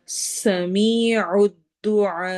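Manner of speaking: سمیع الدعاء slow, whistling س, long ی; slow recitation of سمیع الدعاء; tajweed recitation. Shown as a demonstration. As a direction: recite slowly, let the س whistle and the vowels stretch. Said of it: "سیٹی کی آواز ہوگی سین میں".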